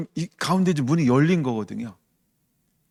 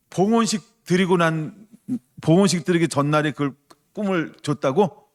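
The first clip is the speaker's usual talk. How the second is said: reenacted wow, raised in pitch; amazed; 4 semitones higher than usual. Pastor reliving hearing of the dream, amazed. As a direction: relive a touch of astonishment at the news.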